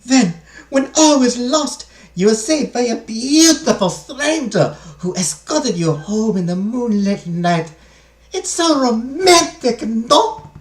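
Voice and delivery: put-on high, slow, swooning maid with a French accent gone German; attempted French accent. Maid gushes over madame's romantic rescue, attempted French accent. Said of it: "I'm trying for a French accent".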